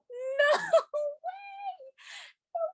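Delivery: high-pitched squeal of disbelief; re-enacting a stunned, ecstatic shriek; retold scream. Fan retelling hearing Kim made top five, screamed disbelief. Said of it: "I screamed".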